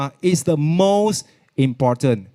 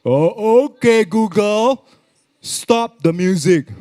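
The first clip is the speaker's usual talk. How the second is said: higher, slower, deliberate uncle voice; imitating my uncle; 4 semitones higher, 4 dB louder, slower than usual. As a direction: drag it out like the uncle commanding Google. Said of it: "I tell you how my uncle speak".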